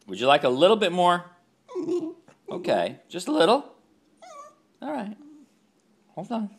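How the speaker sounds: very high excited coaxing; high loud pet-voice. Real, not acted. Offering more, very high.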